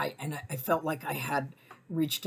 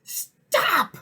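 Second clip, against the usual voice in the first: much higher, loud stern yell; commanding shout; 8 dB louder than usual. Retold or imitated. Narrator blocking the pit bull, shouting at the dog. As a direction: order the dog to stop. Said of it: "and I go".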